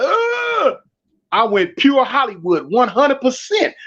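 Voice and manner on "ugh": high, strangled "ugh" pushing spit out; hammed-up seizure yell; retold fake-seizure holler. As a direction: go pure Hollywood with a choked cry. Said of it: "with the holler".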